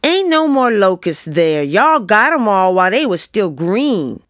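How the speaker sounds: put-on loud, high-pitched childish scorn; scornful boy. A narrator's reading. Joey scornful of the locust hunt, dismissing it loudly. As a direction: shoot the idea down high and loud. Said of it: "Joey was scornful".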